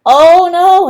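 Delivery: high, loud oh no; hammed-up dismay; dismayed mother. A narrator's reading. Mother giving up on the tooth, mock dismay.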